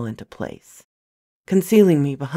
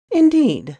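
much higher, louder, mocking; haughty woman; 12 semitones higher, 4 dB louder than usual. A narrator's reading. The woman greeting Alex, high haughty voice. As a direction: sing it out with superiority.

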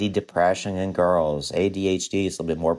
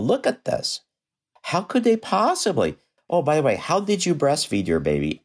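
mock disbelief; 9 semitones higher than usual. Showing parents photos in lectures.

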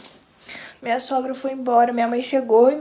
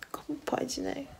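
whispering; 14 dB quieter than usual. Real, not acted. Overwhelmed by love for baby.

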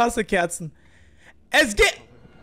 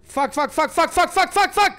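fuck repeated, rising higher and louder; building panic; 6 semitones higher, 4 dB louder than usual. Real, not acted. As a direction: swear faster as panic grows. Monster appears, panicking.

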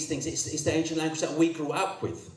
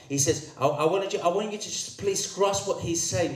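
ordinary talk

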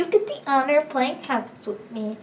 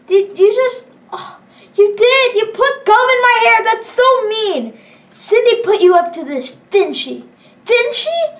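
high, loud whiny outburst; upset girl; 7 semitones higher, 5 dB louder than usual. Acted. Lily accusing the player over gum in her hair, high and loud. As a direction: wail it like a wronged schoolgirl.

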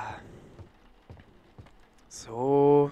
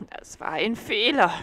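cat crying out; 10 semitones higher, 7 dB louder than usual. A narrator's reading. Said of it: "rief die Katze".

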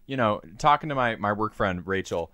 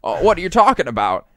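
put-on slightly higher old-movie actor voice; lightly imitated flustered film star; Jimmy Stewart; 4 semitones higher, 5 dB louder than usual. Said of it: "it's fucking Jimmy Stewart talking".

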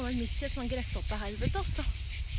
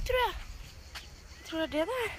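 ordinary talk, nothing marked

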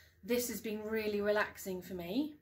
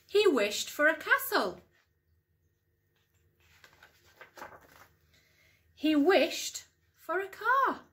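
lively storytime voice with big rises on the wishes; bouncy picture-book narration, hushed at the end; reading to children; 5 dB louder than usual. Acted. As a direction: read it like bedtime storytime for young kids. Mum reads picture book aloud.

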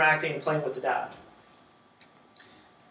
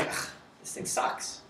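disgusted grumble; 7 dB quieter than usual. Retold or imitated. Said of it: "You'd be like".

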